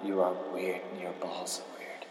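breathy, unvoiced whisper; whispered insult. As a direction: hiss it as a secret jab.

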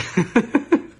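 a real laugh after the punchline; a loud laugh at her own comeback; high rising laugh. Real, not acted.

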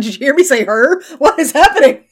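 Southern twang creeping in; Texas accent. Real, not acted. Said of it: "a little Alabama with your Texas accent".

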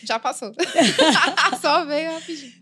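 laughing through the words; chuckling it off. Real, not acted. Laughing after nearly sneezing.

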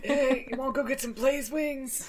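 casual frat bro invite; laid-back bro asking a buddy out. Pretend. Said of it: "That's my bro voice".

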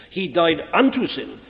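"unto" weighted, falling off on "sin"; stress on unto. Shown as a demonstration. Preacher restating the point, stressing "unto". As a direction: press "unto" in "He died unto sin". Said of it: "Notice that I'm emphasizing unto".

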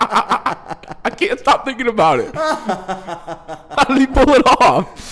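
high, slow words squeezed through laughter; helpless laughter. Real, not acted. Comic unable to get over the story, helplessly laughing. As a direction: talk while cracking up.

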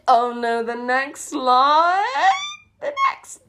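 loud, drawn-out rising wail, half laughing; groan-laugh in dread, shooting up in pitch; cringing dread. Real, not acted. Dreads reading the next line.

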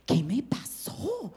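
soft, slow, stunned; ashamed murmur. Retold or imitated. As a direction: ask it in quiet dismay. Avergonzada a la mañana. (se pregunta avergonzada, bajito)

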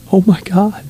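stunned exclamation; shocked. Retold or imitated. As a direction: gasp it out in disbelief. Shocked at her reply.

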